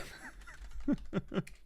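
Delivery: soft, high snicker; quiet voiceless giggle; breathy laugh. Real, not acted. Streamer dreading the next spin, soft breathy laugh.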